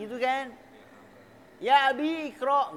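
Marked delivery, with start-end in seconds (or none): 1.6-2.7 "Abi" held long before a fully sounded "ikro"; high, loud "Abi-ikro", both vowels heard; wrongly separated vowels. Shown as a demonstration.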